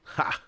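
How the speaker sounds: short, sharp "ha" laugh; single laugh. Real, not acted. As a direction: let out one sudden burst of laughter. Amused by the quote.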